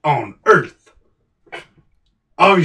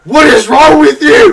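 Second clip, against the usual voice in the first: high, very loud outraged yell; furious shout; 14 semitones higher, 12 dB louder than usual. Acted. Veteran rages at sound effects.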